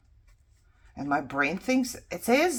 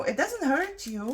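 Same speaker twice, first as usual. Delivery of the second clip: higher, lightly voiced brush-off; mock dismissive outsider; 9 semitones higher than usual. Speaker mimicking people who downplay word loss, mock dismissive. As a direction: imitate someone shrugging it off. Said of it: "You could say".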